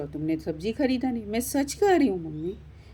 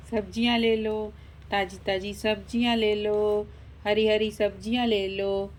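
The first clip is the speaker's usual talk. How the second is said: vegetable seller's cry. Acted. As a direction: hawk your wares, stretching each phrase. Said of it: "सब्जी बेचने वाले की आवाज़ सुनाई दी". (सब्ज़ी वाले की लंबी खिंची हुई पुकार)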